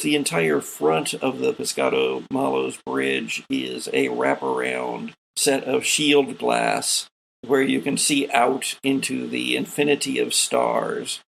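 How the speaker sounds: ordinary talk, nothing marked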